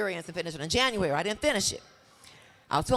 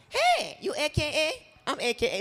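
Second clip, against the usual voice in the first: put-on slow, drawn-out New Orleans accent, Hey sung higher; 6 semitones higher, slower than usual. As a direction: greet a sorority sister the New Orleans way. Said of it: "They'll say things like".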